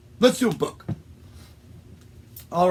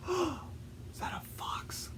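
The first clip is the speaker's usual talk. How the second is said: hushed, slow whispered question; very soft, voiceless aside; 13 semitones higher, 16 dB quieter, slower than usual. Acted. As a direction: whisper it so the fox won't hear. Hushed reaction to fox arriving.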